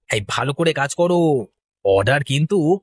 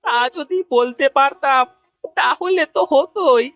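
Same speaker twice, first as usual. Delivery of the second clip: high, plaintive whine; theatrical weepy despair; tearful; 6 semitones higher than usual. Acted. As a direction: lament helplessly through tears. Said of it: "এভাবে কাঁদছো কেন?".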